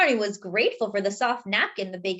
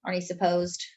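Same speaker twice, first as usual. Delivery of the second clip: usual speaking voice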